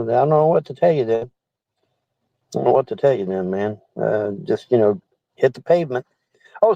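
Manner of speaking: ordinary talk, nothing marked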